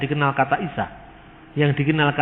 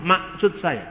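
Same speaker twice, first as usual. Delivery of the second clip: "maksud" said as "masut", K dropped and final D as T; Batak accent; 5 dB quieter, slower than usual. Shown as a demonstration. Lecturer mimics a Batak speaker, soft and mild. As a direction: drop the K in "maksud", Batak style. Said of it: "Orang Batak sulit untuk mengatakan huruf K di tengah".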